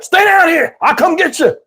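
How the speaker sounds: high, urgent mock shout; shouted order. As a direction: call down to the family as a commanding granddad. Granddad orders family to stay below.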